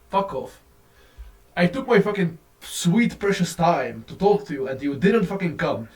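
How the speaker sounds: ordinary talk, nothing marked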